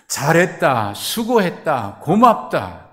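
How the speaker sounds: loud, high, proclaiming praise; God's praise. Acted. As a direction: call it out like God praising his servant. Pastor voices God's praise. (크고 높게 외치는 칭찬)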